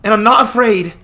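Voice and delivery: put-on a girl insisting she's grown up; defiant shout. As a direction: fling it at him in proud defiance. Lisha defiant after shouting.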